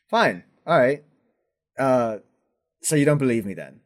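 a lightly voiced knight, getting annoyed; irritated knight. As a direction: press him, offended but restrained. Arthur irritated in character.

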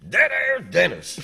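loud, high-pitched drawn-out greeting; Tim Wilson. Retold or imitated. Towering comic greeting the radio host, big loud impersonation. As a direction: boom it down at a smaller man. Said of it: "pretty good Tim Wilson impersonation".

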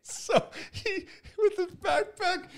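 high laughing through the words. Real, not acted. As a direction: giggle while telling it. Laughing through funny story.

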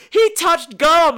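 high, loud and slow mock accusation; imitating a friend's shocked tattling; scandalised friend. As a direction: announce it like a scandal. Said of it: "And she's like".

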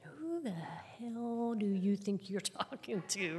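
drawn-out, mock-offended 'who the hell'; slow, swooping mock outrage; indignant inner retort. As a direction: stretch it out, slow and incredulous, laugh bubbling under. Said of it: "but my head said it".

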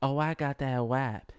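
lightly voiced slow rap as [name]; half-hearted [name] take, drawn out; [name] impression. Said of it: "I do, do a [name]".

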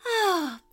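high, falling exasperated sigh; loud sigh. A narrator's reading. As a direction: heave an audible owl sigh. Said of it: "The owl sighed loudly".

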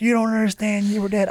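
high, insistent retold plea; imitating frantic wife. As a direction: mimic a wife desperate to convince. Acts out frantic wife pleading.

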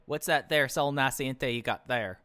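a hint of a comical Southern Ohio yokel asking; mock Southern Ohio accent. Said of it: "weak attempt at a Southern ... accent".

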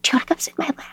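soft unvoiced whisper to a scared child; gentle whisper. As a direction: hush it, coaxing and tender. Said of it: "talking to her softly".

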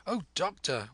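high-pitched, worried appeal; anxious cry. A narrator's reading. Mother and son greet the doctor, high and anxious. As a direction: cry out for help in alarm. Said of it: "we cried".

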